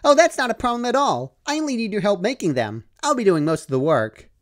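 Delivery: high, chirpy pony voice, upbeat; cheerful Pinkie Pie. Acted. Pinkie reassures Dash she'll do the work, high and chirpy. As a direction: chirp it brightly.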